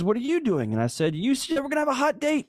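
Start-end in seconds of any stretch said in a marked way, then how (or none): 0.0-0.7 mock wife voice. Wife echoing back at husband. 1.1-2.5 whiny protest. Retold or imitated. Husband protesting in high voice.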